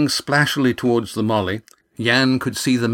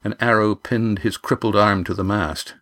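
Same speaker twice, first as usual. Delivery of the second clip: usual speaking voice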